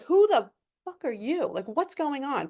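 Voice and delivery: high, shocked confrontation; mock high alarmed shout; retold alarmed demand. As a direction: confront a stranger in the house. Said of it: "I'm like".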